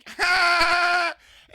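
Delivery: high, loud burst of laughter; loud shrieking laugh. Real, not acted. Telling a friend the subway story, shrieking laugh.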